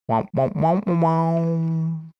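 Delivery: rising womps, last one held; sung womp noises. Acted. Streamer imitating a game creature, drawn-out womping noise. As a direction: chant womps climbing in pitch.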